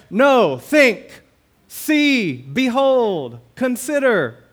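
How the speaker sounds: each word called out high and loud; preacher's rising summons; ringing call. Acted. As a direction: call the people to behold. Calling out imperatives.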